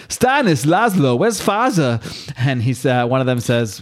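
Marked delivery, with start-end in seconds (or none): 0.1-2.0 put-on a higher, lightly German-accented call; a touch of a German accent, redone on purpose. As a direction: call for father with a hint of German. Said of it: "I don't know why he's German in my mind".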